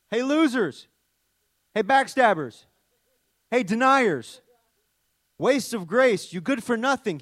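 mock harsh insults. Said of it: "He didn't call out".

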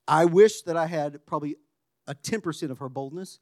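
ordinary talk

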